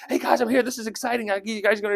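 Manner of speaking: put-on high-pitched, excited kid brother; eager youngster. Young David joining his brothers at battle, eager youngster. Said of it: "he's the young one, right?".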